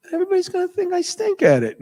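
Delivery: high, strained mock complaint; hammed-up high-pitched objection; mock protest. Retold protest to developers.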